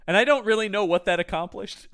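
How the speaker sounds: ordinary talk, nothing marked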